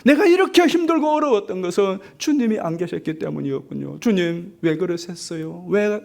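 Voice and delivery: high, pleading protest, building up; complaining to the Lord. Acted. Dreamer arguing with Jesus on the beach, pleading protest. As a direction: argue with God, hurt and demanding. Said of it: "막 따지는 거예요".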